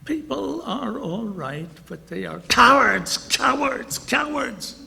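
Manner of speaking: high, then loud on cowards, cowards, cowards; old lady's outburst. Retold or imitated. Old lady on the bus denouncing people, high and loud. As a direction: mimic her rising to a loud cry of cowards. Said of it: "the old lady said".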